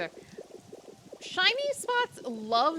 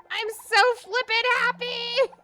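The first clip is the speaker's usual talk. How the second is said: even louder and higher, almost screaming; real joy; 10 semitones higher, 6 dB louder than usual. Real, not acted. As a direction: push the joy over the top. Repeats, more intense.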